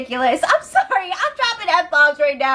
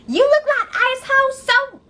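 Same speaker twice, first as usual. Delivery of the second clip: retold scream; 13 semitones higher than usual. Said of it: "So then Raven starts screaming".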